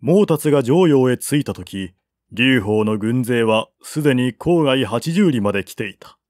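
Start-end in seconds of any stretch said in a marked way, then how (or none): none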